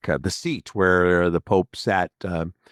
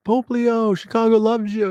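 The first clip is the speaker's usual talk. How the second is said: mock fan cheer; 14 semitones higher than usual. Fan calling to the Pope from the crowd, high eager cheer. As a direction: call out to the Pope like a beaming fan. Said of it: "Not, "Hey, fellow Chicagoan".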